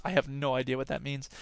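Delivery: usual speaking voice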